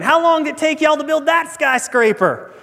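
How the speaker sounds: high-pitched, nosy tourist question; Texan tourist piping up. Acted. Texan asking the tour guide about a skyscraper, piping up high. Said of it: "the Texan pipes up again".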